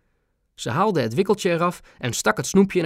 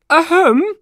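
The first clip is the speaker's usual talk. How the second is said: high, loud ahem to interrupt; queen clearing her throat to stop the chatter; loud royal throat-clear; 8 dB louder than usual. A narrator's reading. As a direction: cut in with a pointed cough. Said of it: "onderbrak de koningin haar".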